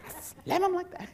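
a hint of the blunt lady answering; imitating lady. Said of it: "She goes".

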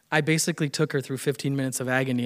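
usual speaking voice

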